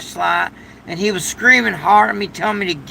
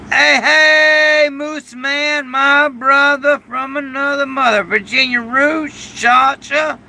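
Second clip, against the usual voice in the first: shout-outs; 4 semitones higher, slower than usual. Real, not acted. Shouting out viewers by name.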